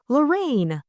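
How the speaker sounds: high-pitched cry of the name; startled exclamation. A narrator's reading. Bundle finding Lorraine at the scene, startled. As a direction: call out in surprise. Startled girl finds her friend.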